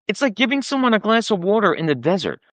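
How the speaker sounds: normal talking voice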